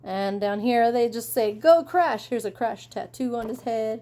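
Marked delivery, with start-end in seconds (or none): 1.5-2.3 cheering fan. Acted. Reading a fan's sign.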